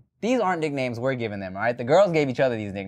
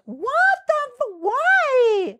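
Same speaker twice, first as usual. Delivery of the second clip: much higher, slow and whining; mock whiny girl; 4 dB louder, much slower than usual. Imitating the disappointed girl to his co-host, high whine. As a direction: wail it like she's let down. Girl's upset reaction voiced.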